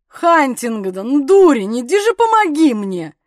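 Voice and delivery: loud, drawn-out plea for a hand; loud, strained cry for help; worn-out call for help. A narrator's reading. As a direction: call out exasperated, struggling and tiring. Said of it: "уже сильно устав".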